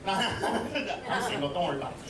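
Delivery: usual speaking voice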